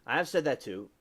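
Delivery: normal talking voice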